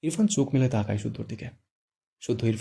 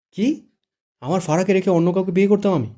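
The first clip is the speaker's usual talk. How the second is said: high, louder shocked outburst; shocked disbelief; 6 semitones higher, 6 dB louder than usual. Acted. Shuddho, shocked, protests he would never leave Fara. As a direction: jump up in pitch in disbelief. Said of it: "শুদ্ধ চোখ বড় বড় করে বলে".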